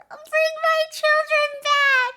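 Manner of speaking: high, loud, slow plea to the screen; anguished wail. Real, not acted. Fan begs the show to return her favourites, with a high, loud wail. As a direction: cry it out like a grieving parent.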